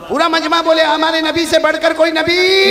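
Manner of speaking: loud, rousing preacher leading the crowd; full-force sermon chant; call-and-response preaching. Acted. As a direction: drive the crowd to answer back.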